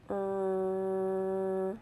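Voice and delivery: "R" as one long held "rrr"; slow sound. Shown as a demonstration. Teacher models a sound for children, "R" held long. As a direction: hold the sound of "R" steadily. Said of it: "say a sound slowly".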